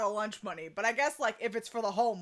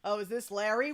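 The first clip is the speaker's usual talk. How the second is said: usual speaking voice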